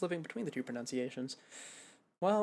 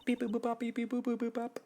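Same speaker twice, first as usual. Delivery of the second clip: high monotone beeps and boops; robotic beeping speech; 8 semitones higher than usual. Acted. Singer talking in an unknown language, high monotone beeping. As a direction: beep and boop steadily on one high note.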